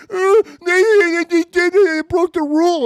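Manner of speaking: high whining mock complaint, stammering; mocking whiny fan. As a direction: whine it like a crybaby fan. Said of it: "They're crying".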